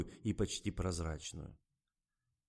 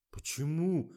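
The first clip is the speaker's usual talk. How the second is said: wide-eyed surprise; 9 semitones higher, 5 dB louder, slower than usual. A narrator's reading. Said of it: "выкатил глаза Жорик".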